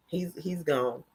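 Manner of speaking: ordinary talk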